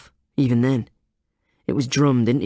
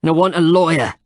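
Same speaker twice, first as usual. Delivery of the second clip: louder and higher, stress on "want"; emphatic; 6 semitones higher, 6 dB louder than usual. A narrator's reading. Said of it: "said Denny emphatically".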